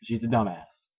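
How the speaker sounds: usual speaking voice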